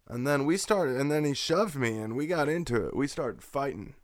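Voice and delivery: normal talking voice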